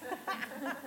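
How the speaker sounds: breathy high giggle; giddy unvoiced laugh, drunk in the spirit; airy giddy titter; giggly laugh.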